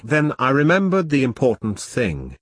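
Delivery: usual speaking voice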